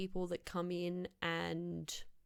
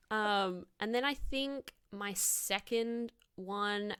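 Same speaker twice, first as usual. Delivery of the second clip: normal talking voice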